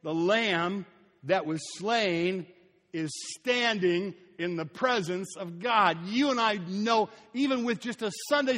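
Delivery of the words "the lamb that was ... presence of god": very slow, deliberate "The lamb that was slain"; preacher driving home the point, slowly; slow emphasis. Shown as a demonstration. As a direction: lay out each word slowly for weight. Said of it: "Get it?".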